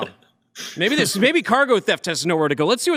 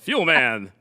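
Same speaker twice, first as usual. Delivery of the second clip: low mock-heroic superhero voice; superhero announcer; 7 semitones lower than usual. Host playing up the superhero name, low mock-heroic announcer. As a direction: announce the name like a caped hero, dropping low. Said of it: "it's like a superhero".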